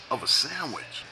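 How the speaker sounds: ordinary talk, nothing marked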